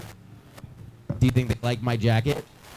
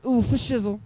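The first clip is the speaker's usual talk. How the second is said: Snoop-style slang; 7 semitones higher than usual. Retold or imitated. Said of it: "She said".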